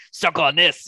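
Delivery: punchy imitation of a movie taunt; retold tough-guy taunt. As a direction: throw it out like a quoted kill line. Acts out killing the pimp.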